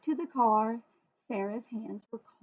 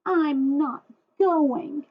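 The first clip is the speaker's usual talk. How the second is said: slow, louder, higher pouting refusal; stubborn refusal; 5 semitones higher, 8 dB louder, much slower than usual. Acted. Daughter refusing her father, slow stubborn pout. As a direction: drag out a sulky no.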